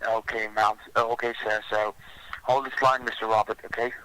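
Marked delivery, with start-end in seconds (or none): none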